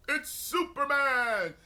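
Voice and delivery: slow, high announcer cry; hammed-up narrator; cartoon announcer. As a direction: boom it like an old cartoon intro. Announcing like old cartoon intro.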